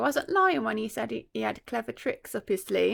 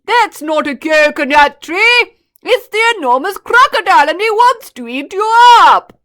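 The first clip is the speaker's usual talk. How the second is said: very loud, higher warning bellow; warning shout; 7 semitones higher, 16 dB louder than usual. A narrator's reading. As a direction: yell to save the child. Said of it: "shouted Humpy Rumpy".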